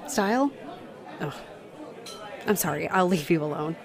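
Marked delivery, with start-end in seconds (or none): 0.1-0.5 style? echoed with a rising question tune; embarrassed echo. Acted. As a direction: repeat style? as a cringing question.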